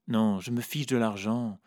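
ordinary delivery, nothing marked

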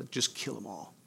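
a soft, cold command, voiced low; quiet menacing order. Pharaoh ordering his armies after the slaves, quiet and cold. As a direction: say it quietly like a ruthless king.

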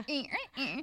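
small high-pitched squeaks of discomfort; mock pained whimpers. Massage client suffering politely on the table, mock whimpering. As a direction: imitate someone wincing under too much pressure.

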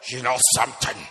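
booming churchy preacher voice; mock preacher voice. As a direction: preach it like a pious brother. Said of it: "when he starts to talk about the Bible".

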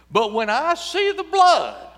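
a preacher's slow booming climax; booming proclamation. Acted. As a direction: thunder out each word slowly. God's line proclaimed slowly.

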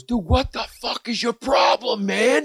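high, strained yell at reduced volume; mock angry yell. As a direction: play the furious driver, voice pushed up high. Retold parking lot confrontation.

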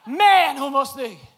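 slow, loud and emphatic; very slow loud insistence; loud insistent. Real, not acted. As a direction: shout it slowly, daring them to laugh. Insists to laughing audience.

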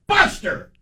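sharp high call of the dog's name; mild mock scold; dad scolding the dog.

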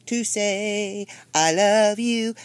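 slow, tender i love you. Retold or imitated. Mother calling her daughter just to say i love you, slow and tender. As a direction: say it slowly and lovingly. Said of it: "looked at her on the phone and went".

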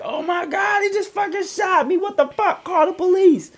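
high, shrieking panic, mocking the victims; frantic high-pitched mock wail; mock panicked screaming. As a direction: imitate them screaming for their lives. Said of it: "They on the ground crying like".

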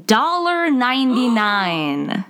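"dollar ninety-nine" drawn out slowly; dramatic reveal. Real, not acted. Amazed slow price reveal.

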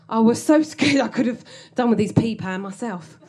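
ordinary talk, nothing marked

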